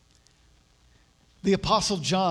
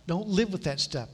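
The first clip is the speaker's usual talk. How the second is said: ordinary delivery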